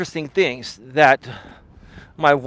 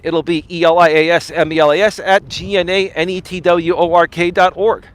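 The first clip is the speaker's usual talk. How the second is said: normal talking voice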